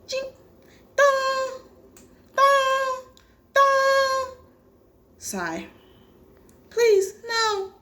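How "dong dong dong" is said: high sung "dong" like a clock bell; bell chime imitation. Gamer imitating the clock chiming, high bell tones.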